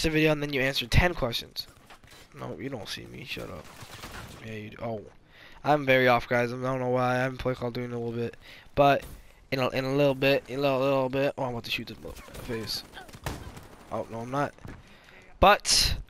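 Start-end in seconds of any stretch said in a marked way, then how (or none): 2.4-5.0 very soft muttering to himself; hushed. Real, not acted. Hiding in game, hushed. 11.4-14.5 fast, soft muttering; quiet gamer mutter. Real, not acted. Mid-game, quiet fast mutter.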